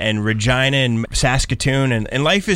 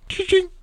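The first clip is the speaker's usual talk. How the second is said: very high imitated intercom chime; ping it out like a cabin chime; mock chime sound. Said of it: "he just goes".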